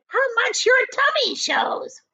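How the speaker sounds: high, loud, sneering aunt; high mocking hag voice; Aunt Spiker's voice. A narrator's reading. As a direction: jab at her sister in a high voice. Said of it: "Aunt Spiker cried".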